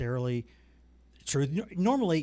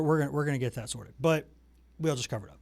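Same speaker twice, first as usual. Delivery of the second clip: ordinary delivery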